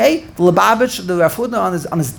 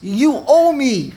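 slow, higher mock demand; 6 semitones higher, much slower than usual. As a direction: order it imperiously, word by word. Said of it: "Moshe is giving Hashem orders".

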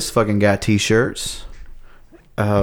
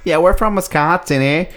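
higher Wisconsin voice that slips into Canadian; female Wisconsin accent; 5 semitones higher than usual. Shown as a demonstration. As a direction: attempt a woman's Wisconsin accent, too Canadian. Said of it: "I think it's still Canadian".

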